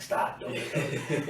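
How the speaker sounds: slow whispered warning; hushed warning. Retold or imitated. Recruits warning friend about sergeant, slow laughing whisper. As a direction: whisper slowly, half laughing. Buddies warning him in line.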